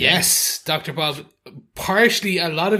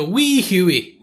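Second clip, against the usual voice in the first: put-on Scottish accent, pitched up; 4 semitones higher, 3 dB louder than usual. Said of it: "that's a Scottish accent".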